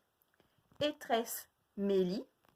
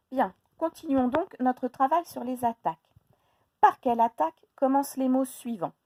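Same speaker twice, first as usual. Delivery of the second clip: louder, clear lesson announcement; teacher voice; 4 semitones higher, 9 dB louder than usual. Acted. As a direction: announce the next exercise to the class.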